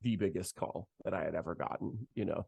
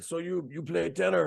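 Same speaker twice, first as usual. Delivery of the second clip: higher, lively voice of the bandleader on the phone; imitating Arturo; 7 semitones higher than usual. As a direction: lightly play the excited bandleader.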